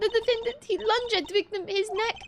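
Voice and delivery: high-pitched witness voice; nervous witness. Acted. Witness testifying to the court about an attack, high nervous voice.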